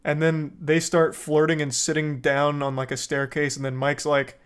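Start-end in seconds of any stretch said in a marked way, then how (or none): none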